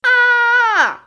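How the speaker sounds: sharp, very high startled scream. Acted. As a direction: shriek as the mummy grabs. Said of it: "I scream".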